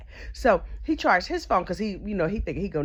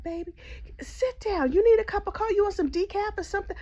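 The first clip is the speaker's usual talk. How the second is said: syrupy high welcome to a grieving neighbor; sweet talk; 11 semitones higher than usual. Retold or imitated. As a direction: coax him like a sugary hostess. Sweet talking the widower.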